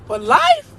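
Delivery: life pitched high and loud; stress on life. Real, not acted. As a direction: hit the word life hard. Preaching emphasis on life.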